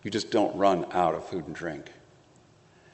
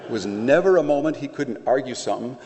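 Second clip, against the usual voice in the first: ordinary talk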